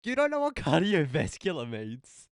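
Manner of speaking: loud, high incredulous question; real disbelief. Real, not acted. Disbelief at co-host not knowing word.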